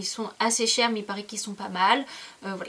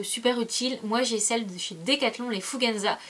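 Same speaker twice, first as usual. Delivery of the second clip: ordinary talk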